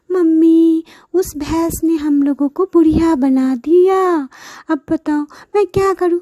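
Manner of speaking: mother mourning her daughters and vowing revenge; distraught mother. Acted. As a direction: wail then threaten the buffalo. Mother laments, threatens.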